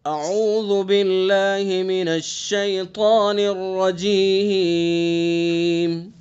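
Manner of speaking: slow melodic tilawat with long drawn vowels; slow Quran recitation. Shown as a demonstration. Teacher recites the surah for students to copy, slow melodic recitation. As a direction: chant each verse slowly, stretching the vowels. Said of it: "سورت التکاثر کی مشق کر لیتے ہیں آج".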